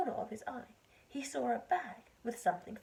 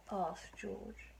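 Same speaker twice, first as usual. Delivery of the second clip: plain reading voice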